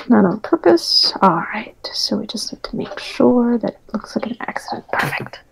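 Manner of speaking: amused unvoiced whisper. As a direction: whisper it like a co-conspirator. Conspiratorial whispered plotting.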